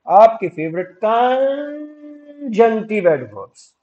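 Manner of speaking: Conjunctive with a long, high held first syllable; slow, stretched build on conjunctive; drawn-out announcement. Shown as a demonstration. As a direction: hold the opening of conjunctive like an announcer. Teacher stretches the term playfully.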